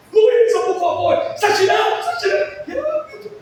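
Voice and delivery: loud pleading yell breaking into laughter; exasperated yell. Retold or imitated. Father yelling at his daughter Luísa, then breaking into laughter. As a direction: shout at her until you crack. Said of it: "Eu parei".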